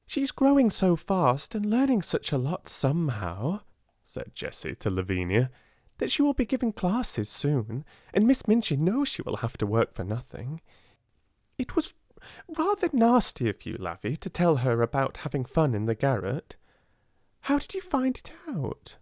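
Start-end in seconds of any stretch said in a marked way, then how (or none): 0.1-3.6 higher, lightly voiced gossiping girl; a silly schoolgirl sharing gossip; chattering schoolgirl. A narrator's reading. Jessie voiced by narrator. 6.0-19.0 higher, lightly voiced gossiping girl; a silly schoolgirl sharing gossip; chattering schoolgirl. A narrator's reading. Jessie voiced by narrator.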